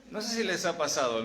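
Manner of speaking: ordinary talk, nothing marked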